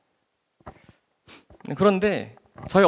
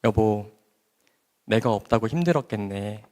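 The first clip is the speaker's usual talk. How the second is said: tender wife; 3 semitones lower than usual. Retold or imitated. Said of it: "세상 그렇게 다정할 수가 없어요".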